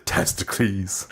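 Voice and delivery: slow Testicles said TES-tuh-kleez; mock Greek name. Shown as a demonstration. Joking that testicles sounds like a Greek name, slow TES-tuh-kleez. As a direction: draw out Testicles like an ancient Greek hero. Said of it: "Like it was an ancient Greek name".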